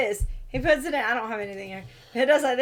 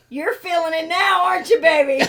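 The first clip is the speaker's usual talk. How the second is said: mock-taunting, louder and higher; gloating retort; 5 semitones higher, 6 dB louder than usual. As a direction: taunt your husband, breaking into laughter. Retold gloating retort to husband.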